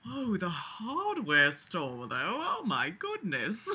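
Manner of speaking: slow, soft, breathy-sounding mock swoon; mocking, dreamy swooning voice. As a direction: gush over the hardware store. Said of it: "he's just like".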